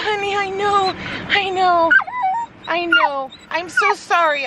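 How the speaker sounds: very high, cooing comfort for a sore dog. Real, not acted. Mom comforting her sedated dog, high cooing baby talk.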